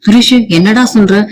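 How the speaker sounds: ordinary delivery, nothing marked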